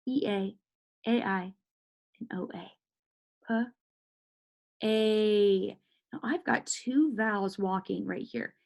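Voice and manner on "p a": "P" as a short sound, then long "A". Shown as a demonstration. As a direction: separate "P" and hold "A" steady. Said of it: "Got that long A sound".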